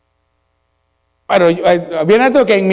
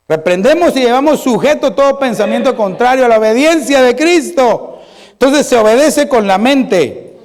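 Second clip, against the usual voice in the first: higher, emphatic pulpit declaration; forceful preaching; 5 semitones higher, 3 dB louder than usual. Acted. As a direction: proclaim it firmly to the congregation.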